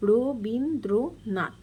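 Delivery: রবীন্দ্রনাথ stretched very slowly, syllable by syllable; syllable split. Shown as a demonstration. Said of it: "তো এখানে দেখো". (রবীন্দ্রনাথ খুব ধীরে দল ভেঙে উচ্চারণ)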